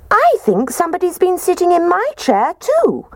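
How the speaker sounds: put-on squeaky Baby Bear. A narrator's reading. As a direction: squeak it like a small cub. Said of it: "And Baby Bear squeaked".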